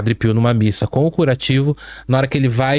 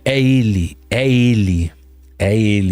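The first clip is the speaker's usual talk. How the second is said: demon voices; 3 semitones lower, slower than usual. Retold or imitated. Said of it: "ele ouve vozes falando".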